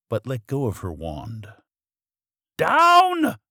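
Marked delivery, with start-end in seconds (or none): 2.6-3.4 very high, loud command; storybook witch crying an order; witch's cry. A narrator's reading. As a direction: call out sharply in a high witch voice. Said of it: "cried the witch".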